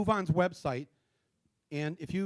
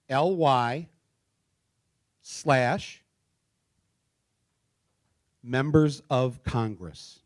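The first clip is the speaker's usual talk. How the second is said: slow dictation; 3 dB louder, much slower than usual. Shown as a demonstration. Dictating the web address slowly to the audience. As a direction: read out slowly, piece by piece. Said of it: "but I'll read it slow".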